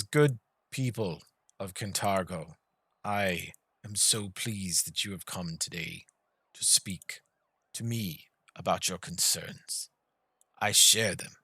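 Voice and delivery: slow, measured, lightly voiced ruler's speech; a silky tyrant soothing the city; lord addressing crowd. Lord soothes the crowd of Kintargo, calm and slow.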